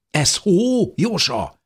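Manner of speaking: high, eager shout up the shaft; excited call. A narrator's reading. Dávid calling up the shaft to his friend, excited. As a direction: call up, thrilled, hardly believing it. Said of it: "kiáltott fel Dávid izgatottan".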